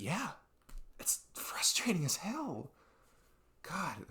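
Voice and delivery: high, breathy, drawn-out exasperation; exasperated whisper. Real, not acted. Venting about hate for being themselves, exasperated whisper. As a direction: squeeze it out, fed up.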